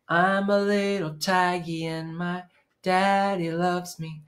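usual speaking voice

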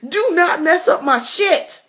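high, giggly warning; laughing high-pitched warning to her father; laughing scold. Retold or imitated. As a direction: scold dad while cracking up.

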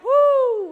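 loud high cheer; excited whoop. Real, not acted. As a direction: celebrate the finished set. Finished set, cheering.

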